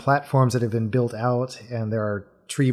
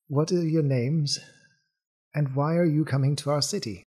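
lightly voiced bird-man, a little higher; bird-man character; 4 semitones higher than usual. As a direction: question the strangers as a curious bird-man.

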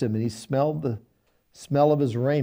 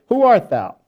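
blind father calling out; 7 semitones higher, 8 dB louder than usual. Shown as a demonstration. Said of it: "Remember, Isaac is, is blind".

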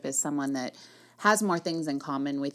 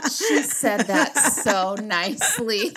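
laughing through the words, slower; 5 semitones higher, 6 dB louder than usual. Real, not acted. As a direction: say it while cracking up. Laughing at friend's age joke.

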